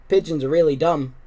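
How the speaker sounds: ordinary delivery, nothing marked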